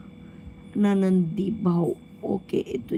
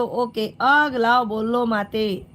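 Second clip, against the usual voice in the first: ordinary delivery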